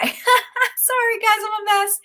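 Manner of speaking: high laughing through near tears; teary laugh. Real, not acted. Host overcome while pitching her course, teary laugh. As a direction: laugh off getting emotional. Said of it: "I'm gonna cry".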